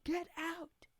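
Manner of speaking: high, strange yell of a voice in the head; mock scream. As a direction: shriek it like a voice inside his skull. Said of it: "A screams in his head".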